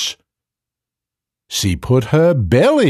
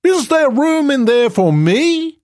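very high, eager elephant. Acted. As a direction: squeal it like a hopeful elephant. Elephant asks to join.

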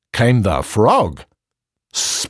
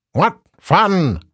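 high frog voice; 7 semitones higher than usual. Acted. Frog speaks in the story.

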